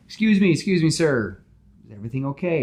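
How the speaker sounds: cheerful, chatty superhero; Spider-Man voice. Acted.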